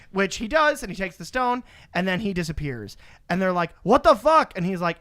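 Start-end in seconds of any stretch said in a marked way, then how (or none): 3.8-4.5 high, loud disbelief; mock shocked outburst. The others react to Strange giving up the stone, mock shocked outburst. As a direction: burst out high and loud, imitating outrage.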